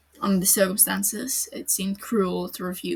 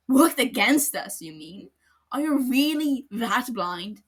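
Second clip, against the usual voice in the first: scornful; 5 semitones higher, 7 dB louder than usual. Acted. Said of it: "Aizawa's voice dripped scorn".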